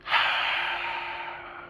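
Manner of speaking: weary sigh. Retold or imitated. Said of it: "and he's just like".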